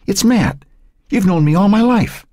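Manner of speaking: high-pitched, urgent plea; pleading. A narrator's reading. Grandson to confused gran who forgot him, pleading.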